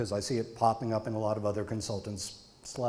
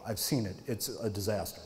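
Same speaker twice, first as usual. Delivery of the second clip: usual speaking voice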